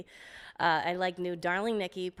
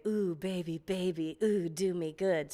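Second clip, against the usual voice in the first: breathy. Retold or imitated. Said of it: "I was the breather".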